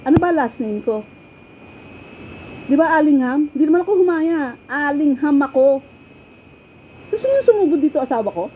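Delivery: half-joking threat to the managers; irritated threat. Retold or imitated. To the managers who pestered her, a loud defiant threat. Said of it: "nabuisit na ako".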